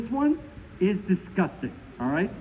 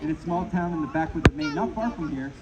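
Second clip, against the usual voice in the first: ordinary talk, nothing marked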